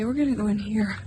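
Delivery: ordinary talk, nothing marked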